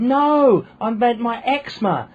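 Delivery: high, loud Essex correction; mock Essex sister-in-law, fed up; exasperated Essex correction. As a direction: correct him sharply in broad Essex. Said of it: "And she said".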